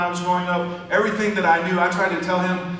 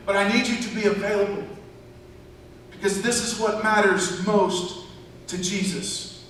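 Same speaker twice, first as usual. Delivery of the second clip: raised, ringing pulpit voice; preaching at full force; 5 semitones higher than usual. Acted. Preacher urging the church to take friends home, full force. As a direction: drive it home to the congregation.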